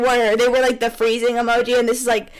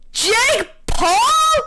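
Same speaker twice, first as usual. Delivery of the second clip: much higher startled question; shocked reveal; 11 semitones higher than usual. Acted. Narrator revealing a twist, high and shocked. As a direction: gasp out the twist.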